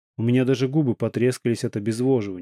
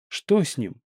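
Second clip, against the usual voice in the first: frightened trembling; 4 semitones higher, slower than usual. A narrator's reading. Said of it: "перепуганный дрожащий голос Марины".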